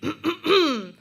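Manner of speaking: loud, theatrical throat clear; dramatic throat clearing. Retold or imitated. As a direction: clear the throat like a big announcement. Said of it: "I cleared my throat dramatically".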